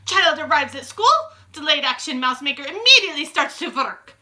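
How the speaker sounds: high, loud Grand High Witch voice; gloating witch. A narrator's reading. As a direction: proclaim it like a scheming witch. Witch continues her speech.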